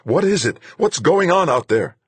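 loud, high anxious yell. Acted. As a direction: yell it through the radio. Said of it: "Duchamp was yelling".

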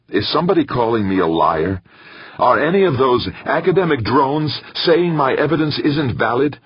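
rising outrage at his critics; losing his temper. A narrator's reading. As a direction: Molina shouting down the committee's doubts.

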